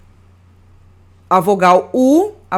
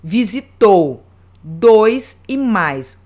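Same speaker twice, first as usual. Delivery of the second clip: "Visitou dois e mais" very slow, the stronger vowel clearly hit; stressed vowel demo; much slower than usual. Shown as a demonstration. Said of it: "Uma vogal é pronunciada mais forte". ("Visitou dois e mais" bem devagar, vogal forte marcada)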